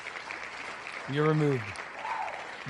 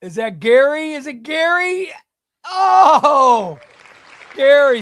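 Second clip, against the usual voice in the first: higher and louder, breaking into a laugh; laughing surprise; 7 semitones higher, 9 dB louder, slower than usual. Real, not acted. Streamer laughing as a donor gets eliminated, laughing surprised shout. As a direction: laugh out the name in disbelief.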